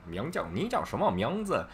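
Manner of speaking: exaggerated northern accent; pretentious northern accent. Shown as a demonstration. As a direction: put on a showy Beijing accent for 你叫什么名字. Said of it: "that would be, like, pretentious".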